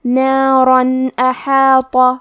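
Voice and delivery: نارن احاطہ very slow, clear nun without nasal hum; izhar recitation. Shown as a demonstration. Teacher demonstrating نارن احاطہ, slow recitation. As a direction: chant نارن احاطہ, nun fully pronounced. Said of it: "بغیر غنہ سے یہ حکم کو ادا کریں گے".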